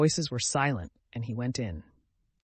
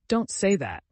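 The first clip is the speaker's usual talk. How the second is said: a quick excited protest, a little higher; 6 semitones higher, 4 dB louder than usual. A narrator's reading. Anna protesting to Betsy, excited. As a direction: blurt it out, worked up. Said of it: "answered Anna's excited voice".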